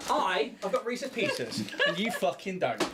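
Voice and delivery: laughing. Real, not acted. Teasing while eating sweets.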